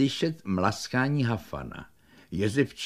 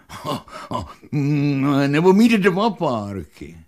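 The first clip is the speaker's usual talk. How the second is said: rising, louder, eager craving; greedy big dog; 2 semitones higher, 3 dB louder, slower than usual. Acted. Big dog Hafan outbidding Alík, rising eager voice. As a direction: build up to wanting two sausages.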